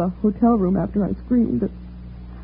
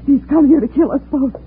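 high-pitched, terrified warning; frightened for her life; panicked; 9 semitones higher than usual. Acted. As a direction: warn him in mounting panic. Woman fears for her life.

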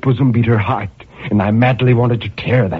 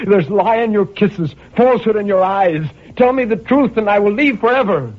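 higher, anguished plea for the truth; desperate lover pleading; 12 semitones higher than usual. Acted. Jealous lover to his beloved, anguished and pleading. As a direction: beg her for the truth, voice straining upward.

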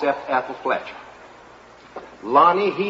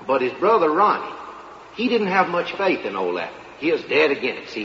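ordinary delivery

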